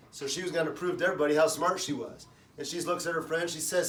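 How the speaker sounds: normal talking voice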